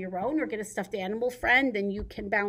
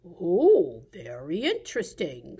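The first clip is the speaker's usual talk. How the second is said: wide-mouthed frog; 4 semitones lower, 4 dB louder, slower than usual. Acted. Frog answers the mouse about cheese, low frog voice. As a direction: lower frog voice with a big "oh". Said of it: "said the frog".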